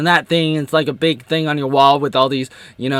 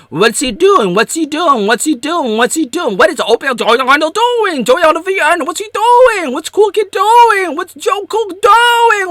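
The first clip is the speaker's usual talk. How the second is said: mocking onlookers; 9 semitones higher, 3 dB louder than usual. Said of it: "it's like".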